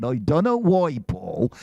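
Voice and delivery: broad Bristol accent, imitated; Dave Prowse, broad Bristolian. Actor complaining his voice wasn't used, broad Bristolian accent. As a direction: put on a thick Bristolian burr, protesting. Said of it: "had a broad Bristolian accent".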